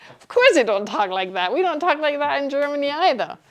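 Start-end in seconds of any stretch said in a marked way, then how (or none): none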